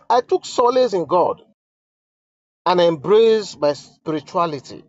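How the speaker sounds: solemn dramatic reading; letter read aloud. Acted. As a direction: read each line as if it were the queen's own plea.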